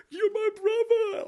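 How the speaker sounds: mock wailing, very high and slow; hammed-up crying brother. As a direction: wail it like the stranger in the front row. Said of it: "and he starts crying".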